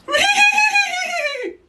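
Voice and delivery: very high imitated neigh; horse whinny. Shown as a demonstration. Dad shows baby what horse says, high whinnying. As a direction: let out a loud squealing whinny. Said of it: "This is horse".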